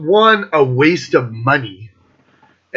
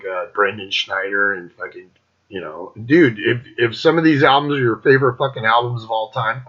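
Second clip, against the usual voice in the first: ordinary delivery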